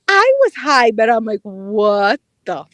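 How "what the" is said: low, spaced-out inner voice; retold stunned disbelief. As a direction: drop lower and break it up in disbelief. Retells her high, stunned thought.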